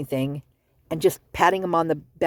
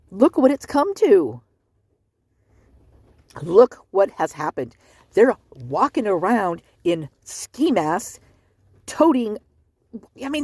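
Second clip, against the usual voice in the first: heated rant; 5 semitones higher than usual. Real, not acted. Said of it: "I'm a little heated right now".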